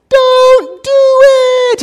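high, drawn-out don't do it; slow high singsong warning; mock pleading call from the sidelines; warning friends. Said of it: "and you're like".

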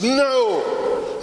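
high, loud protesting no; desperate cry. Retold or imitated. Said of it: "I just grabbed him and says".

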